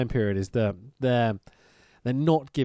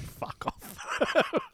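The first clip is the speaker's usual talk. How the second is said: unvoiced whispered dismissal; 6 dB quieter than usual. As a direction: breathe it out as a whisper. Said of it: "I'm like".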